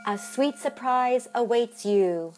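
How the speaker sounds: slow, mystic fortune voice; fortune-teller read. Acted. Reading a fortune aloud to the listener, slow and mystic. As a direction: intone it like a fortune cookie oracle.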